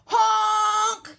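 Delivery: loud, high, blaring honk; yelled honk. A narrator's reading. Dump truck barges past, yelled honk. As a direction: blast it like a big truck's horn. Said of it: "yelled a dump truck".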